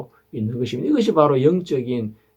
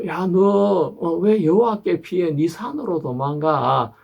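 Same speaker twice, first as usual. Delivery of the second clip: tempter's taunt; 6 semitones higher, slower than usual. Retold or imitated. The evil one taunting believers to flee, higher and mocking. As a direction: goad the listener to run away.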